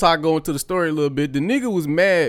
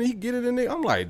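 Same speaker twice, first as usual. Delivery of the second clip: ordinary talk, nothing marked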